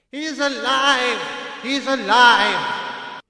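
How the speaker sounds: high, slow joyful outburst; joyful cry. A narrator's reading. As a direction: cry out with relief. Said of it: "he cried".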